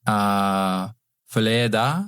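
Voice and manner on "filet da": "filet da" tried out in a French accent, rising like a question; tentative French. Shown as a demonstration. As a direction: test the French phrase "filet da" hesitantly. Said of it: "Great accent".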